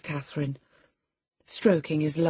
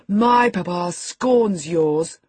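put-on loud, slow boyish taunt; crying out scornfully; 3 semitones higher, 6 dB louder, much slower than usual. A narrator's reading. Boy boasting of his Papa to his cousin, crying out. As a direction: throw it at her, drawn out. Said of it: "cried Linton".

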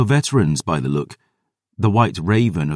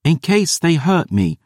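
put-on much higher, defiant girl; girl defying her guardian, much higher; 12 semitones higher than usual. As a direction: chin up, throw it back at him. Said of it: "She squared her shoulders defiant".

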